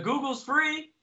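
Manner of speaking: high-pitched smart-aleck retort; sarcastic. Retold or imitated. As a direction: toss it off sarcastically. Snarky reply to the cop.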